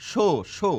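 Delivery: isolating the o vowel of সন্ধ্যা; stressed o sound. Shown as a demonstration. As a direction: sound out সো with a rounded o. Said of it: "এখানে একটি ওকার হবে".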